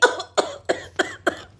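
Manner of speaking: a dry, hammed-up coughing fit; put-on cough. Mother-in-law coughs mid-scolding, hammed-up. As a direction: fake a harsh cough.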